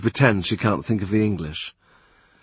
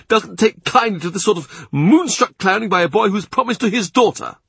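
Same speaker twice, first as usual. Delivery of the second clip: higher, louder imperious churchman; angry Cardinal; 7 semitones higher, 3 dB louder than usual. A narrator's reading. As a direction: pounce on every excuse, rising in anger. Cardinal rants about Percy.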